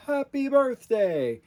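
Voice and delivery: high, loud, drawn-out exclamation; snowman's birthday cry. Retold or imitated. Snowman just brought to life, high joyful cry. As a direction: shout it slowly in a high mock Frosty voice. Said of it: "He says".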